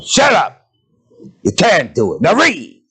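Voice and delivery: mock father snapping at his boy; angry father shout. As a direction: yell it at the sleepy child. Father yelling at child.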